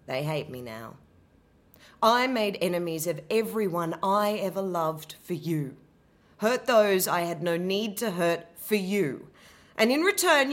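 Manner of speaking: bitter, wounded Medea, building to sarcasm; an abandoned wife raging at Jason; Medea's lament. Acted. Abandoned wife confronting Jason, bitter and wounded. Medea monologue, first take.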